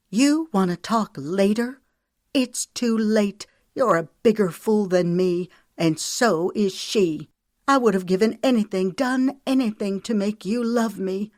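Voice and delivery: high, loud accusing outburst; angry wife lashing out at her husband; blazing anger. Acted. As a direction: hurl it at him, stung and furious. Her eyes were blazing.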